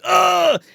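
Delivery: hammed-up agonized yell; pained shout. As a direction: yell as if stabbed. Corky stabbing himself in cabin.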